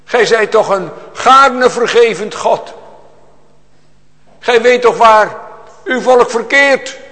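slow, loud and high, begging for mercy; imploring cry to a forgiving God; pleading prayer. Retold or imitated. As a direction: lift the voice and plead, drawing out each word. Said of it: "Ze kunnen alleen maar zeggen".

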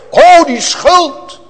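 high, loud, drawn-out cry of guilt; uitgeroepen noodkreet. Acted. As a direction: cry out slowly to God in desperate need. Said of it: "Hij schreeuwde tot God". (luide, langgerekte noodkreet)

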